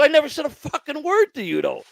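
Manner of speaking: high-pitched, disbelieving protest; indignant protest. Retold or imitated. Inmate to the counselor who dislikes him, indignant protest.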